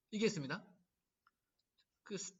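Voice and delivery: normal talking voice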